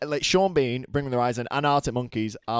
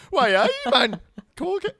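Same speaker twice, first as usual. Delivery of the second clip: mock Geordie kid voice; 10 semitones higher, 4 dB louder than usual. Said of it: "and you go".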